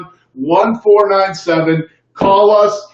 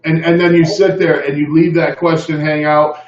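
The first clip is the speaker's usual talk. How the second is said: ordinary talk, nothing marked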